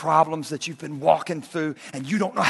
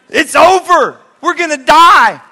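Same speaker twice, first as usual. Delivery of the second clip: loud panic; 7 semitones higher, 12 dB louder than usual. Acted. Disciples panicking in storm.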